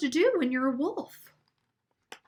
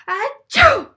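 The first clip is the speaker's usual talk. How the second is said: loud sneeze; 12 semitones higher, 11 dB louder than usual. Acted. Wolf sneezes again.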